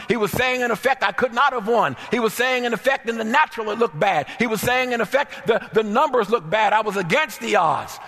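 high, loud, fervent preacher's cry; full-force preaching. Acted. Preacher to congregation about David's breakthrough, full-force preaching. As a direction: preach it at the top of your voice.

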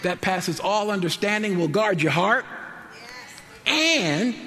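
preaching. Acted. Preacher whooping the promise to the congregation. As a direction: preach it out with rising fervor.

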